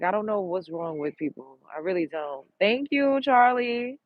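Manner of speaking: ordinary talk, nothing marked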